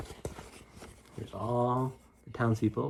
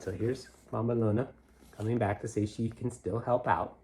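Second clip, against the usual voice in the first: usual speaking voice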